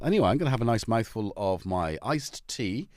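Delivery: normal talking voice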